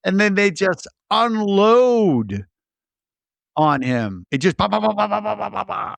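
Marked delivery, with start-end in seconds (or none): 4.3-6.0 gunfire noise. Retold or imitated. Mimicking gunfire.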